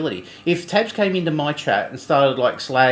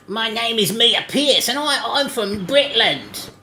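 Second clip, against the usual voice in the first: slow, higher put-on British accent, a first rough try; lightly hammed British accent, needs practice; mock British accent; 7 semitones higher, slower than usual. Trying a British accent for the co-host, slow mock British. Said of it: "you need a bit more practice with that".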